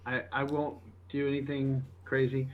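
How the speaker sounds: ordinary talk, nothing marked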